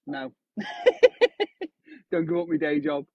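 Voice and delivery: ordinary talk, nothing marked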